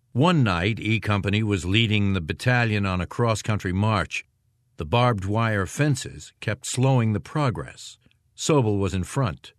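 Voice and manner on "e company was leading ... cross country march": ordinary delivery, nothing marked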